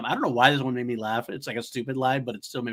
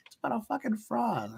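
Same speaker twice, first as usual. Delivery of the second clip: high, soft, disbelieving; mock outrage in a high voice; mock incredulous; 10 semitones higher, 7 dB quieter than usual. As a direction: act baffled by the frog. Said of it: "I'm like".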